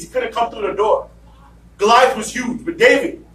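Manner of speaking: ordinary delivery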